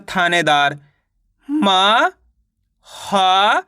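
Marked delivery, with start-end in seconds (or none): 1.5-3.6 "मा, हा" one at a time, each drawn out with rising tune; reading lesson sound-out of "मा, हा"; sounding out syllables. Shown as a demonstration. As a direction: stretch "मा" and "हा" separately, lifting each. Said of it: "तो मिलके बन जाएगा महादेव".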